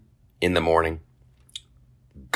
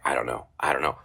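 muttered evasion; 5 semitones lower, 7 dB quieter, faster than usual. Retold or imitated. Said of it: "I'm like".

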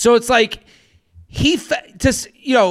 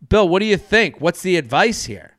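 ordinary talk, nothing marked

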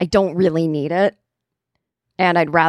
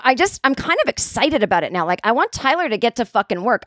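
ordinary talk

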